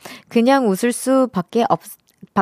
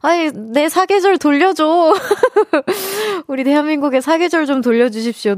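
whiny plea; 7 semitones higher than usual. Acted. Whining about lost seasons.

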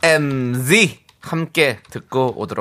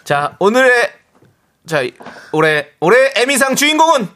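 rising host announcement; award show announcer; 6 semitones higher, 3 dB louder than usual. Pretend. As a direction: build suspense like an awards host.

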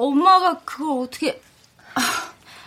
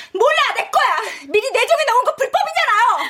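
higher, insistent protest; stubborn insistence; 6 semitones higher than usual. Acted. Woman insists to the employee the apartment is hers, protesting. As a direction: refuse to back down.

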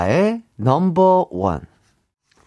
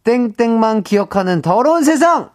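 catchphrase shout; 7 semitones higher than usual. Retold or imitated. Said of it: "박성광 씨의 대사가 유행어".

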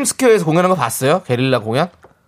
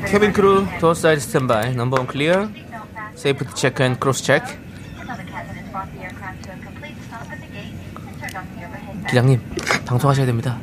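flight attendant; 7 dB quieter than usual. Acted. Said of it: "네 영어가 난 너무 웃겼어".

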